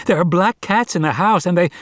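high, pressing shout, cut off. A narrator's reading. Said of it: "shouted Varjak".

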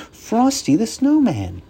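put-on high child's delighted naming; little girl voice. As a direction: announce it like a happy kid. Kids naming him.

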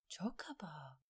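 whispered thought to herself; hushed, private aside under the breath; inner thought. A narrator's reading. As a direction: whisper it like an unspoken remark. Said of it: "diye düşündü Alain".

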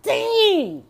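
high drawn-out cry of dismay; stunned comic outburst; shocked exclamation. Retold or imitated. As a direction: let one high word slide down in disbelief. Shocked reaction to movie's plot twist.

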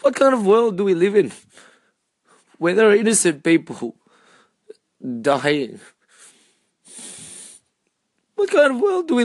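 pleading through rising tears; emotional, near tears. Real, not acted. Grieving aloud for innocent victims, voice breaking and rushed. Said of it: "I'm getting really emotional about this".

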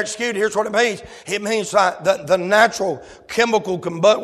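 usual speaking voice

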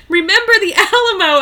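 high, loud cry through laughter; laughing battle cry. Acted. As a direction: yell it while cracking up. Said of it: "I cried".

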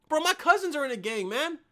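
ordinary talk, nothing marked

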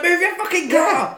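high, loud mock yell. Snake Lady yelling at the old woman, mock yell. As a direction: yell it like an angry driver. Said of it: "She's like".

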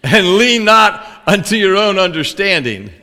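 loud, high, drawn-out Bible verse; big, measured scripture reading; slow emphatic recitation. Shown as a demonstration. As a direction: declaim it slowly, phrase by phrase. Continues hammed-up verse reading.

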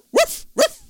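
high, loud imitated woofs; dog barks. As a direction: yap like an excited dog.

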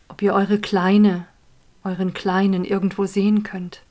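calm, unhurried guiding voice; guided meditation. Acted. Leads a meditation.

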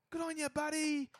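high, loud mock Canadian fan; hammed-up friendly Canadian heckle; Canadian accent. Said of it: "the fans were fucking".

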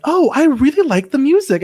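high, sing-song mom impression; imitating mom. Quoting mom after a Marvel movie, high imitation. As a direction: gush it high, imitating a pleased mother. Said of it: "That is my mom's number one compliment".

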